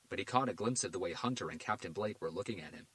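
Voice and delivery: ordinary delivery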